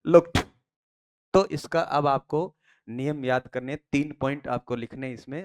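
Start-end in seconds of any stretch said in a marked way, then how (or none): 0.0-0.4 लुक्ड said as lukt, ending in a crisp t; लुक्ड pronounced lukt with a hard final t; correct t ending. Shown as a demonstration. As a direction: end लुक्ड on a sharp t, not d. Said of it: "it is टे".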